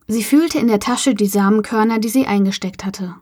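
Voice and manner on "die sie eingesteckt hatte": ordinary delivery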